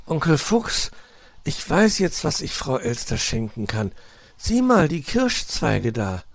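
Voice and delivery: put-on high young fox with a sudden idea; enthusiastic. A narrator's reading. Nephew spots cherry branches for a gift, enthusiastic. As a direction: burst out eagerly. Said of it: "von Weißohrs Begeisterung".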